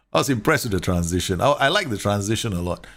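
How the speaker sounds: ordinary talk, nothing marked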